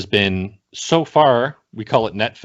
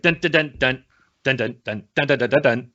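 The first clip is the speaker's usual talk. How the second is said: punchy vocalized intro beat; opening theme imitation; 3 semitones higher than usual. Shown as a demonstration. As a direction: sing out a drum intro on "dun". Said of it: "the opening be like".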